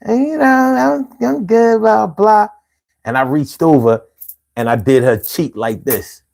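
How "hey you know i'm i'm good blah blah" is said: put-on higher, slow girly voice; imitating girlfriend. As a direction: play her sweet, slow reply. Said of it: "And she's like".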